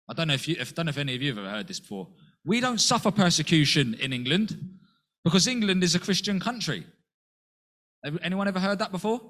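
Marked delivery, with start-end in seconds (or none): 2.4-6.9 higher and louder, scoffing at a common saying; mocking quote. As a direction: mimic the smug claim, loud and high. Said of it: "so many times people say it".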